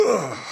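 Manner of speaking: sudden high, loud grunt of pain; mock yelp of a man shot in the back. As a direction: cry out as a bullet hits your back. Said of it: "So he was like".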